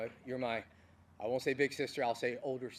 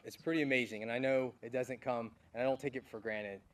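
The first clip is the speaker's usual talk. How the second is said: normal talking voice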